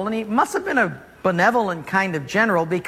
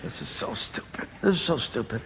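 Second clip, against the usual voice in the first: whispered, much softer disgusted mutter; grumbling under breath; 4 semitones lower, 9 dB quieter than usual. Acted. Naaman muttering alone in the river, whispered disgust. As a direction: mutter it so nobody hears.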